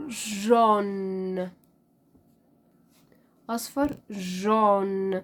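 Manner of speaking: جون drawn out very slowly as zhon; slow French jaune. Shown as a demonstration. Teacher to class, modeling French yellow very slowly. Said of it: "حرف ال A وحرف ال U بتنطق O".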